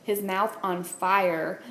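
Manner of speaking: ordinary delivery, nothing marked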